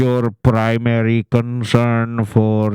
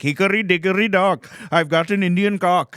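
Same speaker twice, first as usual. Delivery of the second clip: put-on higher, sing-song Indian accent rhyme; mock Indian accent; 9 semitones higher than usual. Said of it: "if we can do the Indian accent".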